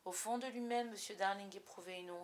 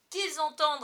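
higher, louder defiance; defiant; 6 semitones higher, 11 dB louder than usual. A narrator's reading. Father defying his wife, loud and defiant. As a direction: throw the challenge back at her. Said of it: "sur le ton du défi".

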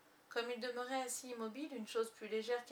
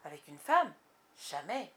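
lower, much louder scornful refusal; proud boy's refusal; 4 semitones lower, 7 dB louder than usual. A narrator's reading. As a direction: refuse with boyish pride. Peter refuse fièrement. (Peter refuse à Wendy, plus grave et fort)

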